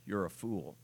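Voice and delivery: low, hushed, stunned reading; reading in disbelief. Shown as a demonstration. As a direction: let the words land softly in disbelief. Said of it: "Let me read that again".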